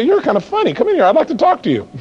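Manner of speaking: high, friendly invitation; amused homeowner. Acted. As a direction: invite the caller in, tickled. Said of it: "That person gets a little, they say".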